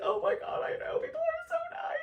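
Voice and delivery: very high, wailing gratitude; hammed-up happy crying; tearful gushing. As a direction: wail it as if bawling at people's kindness. Retold tearful agreement with therapist.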